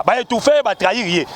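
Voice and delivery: normal talking voice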